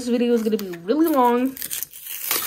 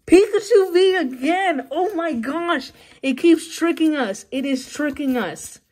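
loud, high, disbelieving cry; excited outburst; 5 semitones higher, 4 dB louder than usual. Real, not acted. Kid sees the same card again, shouting in loud disbelief. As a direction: burst out in disbelief at the repeat pull.

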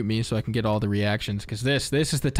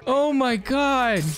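high, long drawn-out exclamation; amazed outcry; 11 semitones higher, much slower than usual. Real, not acted. Gamer floored by the new gun, amazed outcry. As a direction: wail it slowly in high-pitched amazement.

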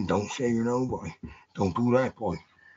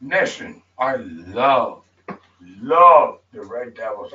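ordinary talk

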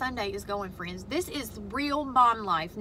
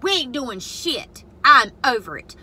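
louder, exasperated blowup; fed-up outburst; 8 dB louder, slower than usual. Retold or imitated. Mom retelling losing her temper at her daughter, louder. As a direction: snap that you're done with it. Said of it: "I said".

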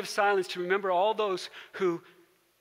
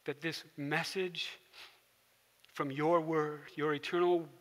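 closing prayer; 3 semitones lower than usual. Real, not acted. Pastor leads closing prayer.

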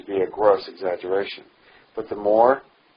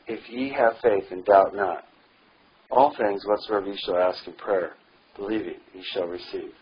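ordinary talk, nothing marked

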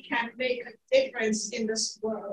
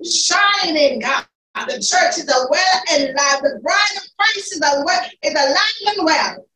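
fervent praise; 3 semitones higher, 6 dB louder than usual. Real, not acted. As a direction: cry out thanks to God at full power.

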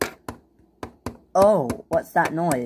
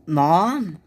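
usual speaking voice

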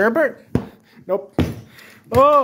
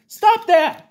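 high, loud scolding yell; sharp parental yell; angry shout; 10 semitones higher, 6 dB louder than usual. Acted. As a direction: snap at the kid to stop. Dad scolding son stabbing sorbet.

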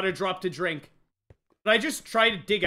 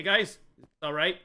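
ordinary talk

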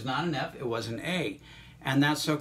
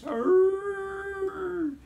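sudden squeal far up high; playful falsetto squeak; squeaky high note; 4 dB louder than usual. Acted. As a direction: jump way up into a squeak.

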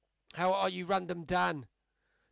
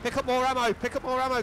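panicking gamer; 5 semitones higher, 4 dB louder than usual. Real, not acted. Panicking mid-fight, repeated shout.

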